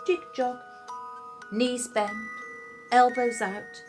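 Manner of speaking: plain reading voice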